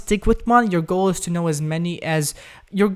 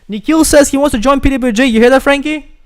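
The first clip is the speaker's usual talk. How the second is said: higher and louder, hyped up; excited hype; 8 semitones higher, 7 dB louder than usual. Real, not acted. Host hyping a viewer's comment to Frankie, excited. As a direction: pump up the stream over the news.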